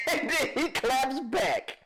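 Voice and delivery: high, drawn-out words through laughter; real giggling squeal, stretched slowly; cracking up. Real, not acted. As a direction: barely get it out for laughing. Cracking up at the argument.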